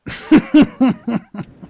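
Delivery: loud, high burst of laughter; laughing. Real, not acted. Cracking up at their own joke about programming, loud laugh. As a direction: laugh out loud in a string of pulses.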